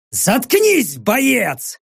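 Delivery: sharp high barked command; barked order. Acted. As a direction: snap the order at her. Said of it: "рявкнул я".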